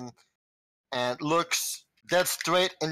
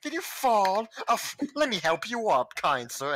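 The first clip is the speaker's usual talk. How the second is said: high, syrupy fake politeness; sugary mock apology to a fallen man; mocking sweetness; 7 semitones higher than usual. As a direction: coo it like you're laughing at him. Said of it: "mocking him".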